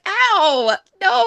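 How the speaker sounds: high-pitched mock wince; retold pained reaction. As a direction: act out recoiling in pain. Acted pained reaction to a scene.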